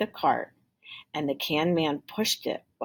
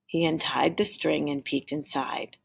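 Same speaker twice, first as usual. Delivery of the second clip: ordinary delivery, nothing marked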